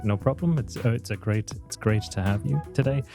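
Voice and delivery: ordinary talk, nothing marked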